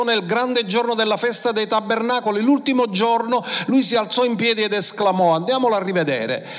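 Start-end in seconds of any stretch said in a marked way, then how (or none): none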